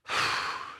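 long breathy exhale; heavy sigh. Acted. Preacher acts out a general sitting down to think, weary sigh. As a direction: blow out a slow breath.

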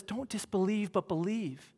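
mild hushed encouragement; gentle plea. Acted. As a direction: quietly invite him to believe. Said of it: "He says".

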